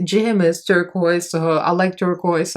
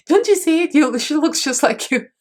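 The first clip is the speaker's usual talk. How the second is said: high, eager mock insistence; excited insistence; 9 semitones higher than usual. Patrick urging her she looks like Raven, excited and high. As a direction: imitate a friend pushing the point excitedly.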